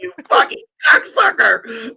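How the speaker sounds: shrieked insult breaking into laughter; laughing shriek. Acted. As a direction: scream it high and laugh. Teasing insult at friend.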